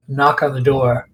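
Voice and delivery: normal talking voice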